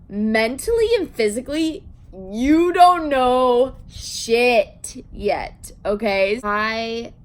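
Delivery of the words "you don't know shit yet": drawn-out, every word stressed; slow emphatic scolding. Real, not acted. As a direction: spell it out slowly. Lecturing teens.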